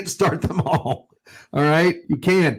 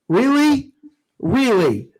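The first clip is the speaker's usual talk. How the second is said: incredulous; 8 semitones higher, slower than usual. Real, not acted. Ranting at a listener question.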